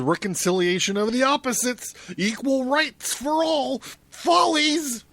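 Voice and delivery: Satan sneering, climbing in pitch to follies; mocking devil, pitch soaring on follies. Satan mocks reconciliation.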